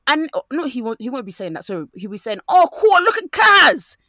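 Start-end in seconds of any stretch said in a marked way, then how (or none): 2.5-4.0 much higher, louder mock cheer; mock excited lad. Mocking a lad gushing over Kaz, high and loud. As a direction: belt it like an eager lad. Imitating a guy's exclamation.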